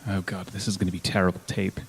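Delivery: ordinary talk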